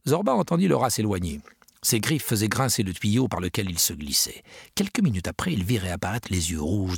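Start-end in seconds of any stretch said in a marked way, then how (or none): none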